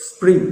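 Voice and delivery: one-syllable স্প্রিং; "spring" with no added vowel in স্প্রিং; correct pronunciation. Shown as a demonstration. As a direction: start স্প্রিং straight on s. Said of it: "সঠিক উচ্চারণগুলো দেখো".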